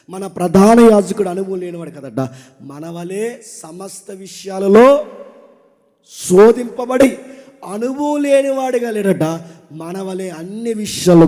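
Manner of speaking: loud pulpit exhortation with punched commands; full-force preaching. Acted. Preacher urging the men of the church, loud and fervent.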